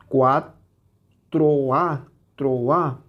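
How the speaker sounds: "cuatro A, troa" broken up, with the A stressed and apart from "tro"; strong stressed A. Shown as a demonstration. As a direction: keep a hiatus before the stressed A in "troa". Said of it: "Se escucha muy fuerte". ("cuatro A, troa" separado, con la A fuerte)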